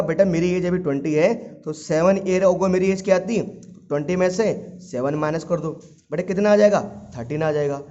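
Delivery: usual speaking voice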